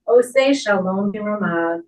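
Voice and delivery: normal talking voice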